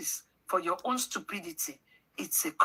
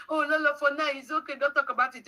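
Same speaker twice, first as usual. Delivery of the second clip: mock pleading advice; 7 semitones higher, 3 dB louder, faster than usual. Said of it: "Nobody can tell me".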